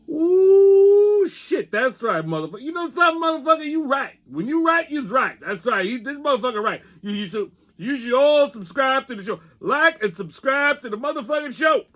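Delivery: high, excited puppet squeal; a puppet hyping the show to viewers; Pop the puppet. Acted. Puppet tells viewers to subscribe, high and excited.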